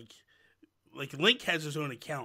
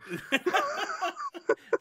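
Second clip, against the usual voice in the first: high squealing laugh; 3 dB louder than usual. Real, not acted. Big laugh at dark joke.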